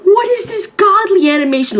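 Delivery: high squealing near-cry of amazement; high, strained mock-crying praise; amazed fanboy squeal. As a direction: shriek it like the animation broke you. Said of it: "I was like".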